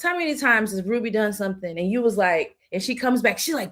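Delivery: ordinary talk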